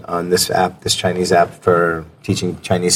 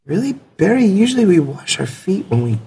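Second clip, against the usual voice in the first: put-on upbeat online teacher calling a kid; 8 semitones higher than usual. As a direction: greet a kid student brightly. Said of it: "I said".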